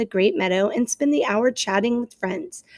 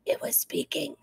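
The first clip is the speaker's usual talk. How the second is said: whisper; 4 semitones lower, 10 dB quieter than usual. Acted. Owl reveals a secret.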